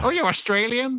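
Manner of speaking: high-pitched, badly faked American; terrible American accent. Mimicking American drive-through staff asking, high bad American accent. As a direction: squeal it as a clueless American. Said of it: "that was a terrible".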